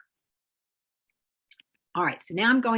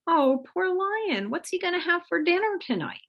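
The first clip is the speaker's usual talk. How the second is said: mock pity; 11 semitones higher than usual. Ancestor chased by a lion, cooing in mock pity. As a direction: gush over the poor lion as if you really cared.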